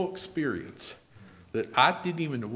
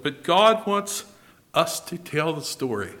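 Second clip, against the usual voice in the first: ordinary talk, nothing marked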